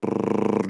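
rolled brrr imitating a snare; mouthed drum roll. Co-host cueing the soup announcement, mouthed drum roll.

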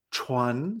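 Chuan sliding upward; second tone on Chuan. Shown as a demonstration. Teacher isolates a syllable, Chuan in rising second tone.

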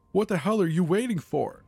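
very high, loud panicked shriek. A narrator's reading. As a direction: scream it at him in terror. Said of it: "Becca shrieks".

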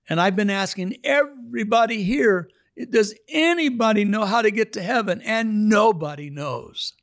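retold cry of a man afraid of hell, high and strained; desperate plea. Dying inmate's desperate question retold.

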